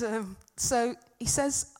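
normal talking voice

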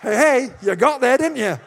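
loud, playful jab at the crowd; loud teasing aside; teasing call-out. Real, not acted. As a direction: rib the congregation for getting the joke. Teasing the congregation aloud.

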